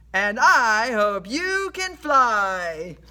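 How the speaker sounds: slow, loud, drawn-out comeback; horse's retort. A narrator's reading. Horse Liberty replies to the sailor, slow loud retort. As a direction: answer the threat slowly and loudly. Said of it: "Liberty replied to him".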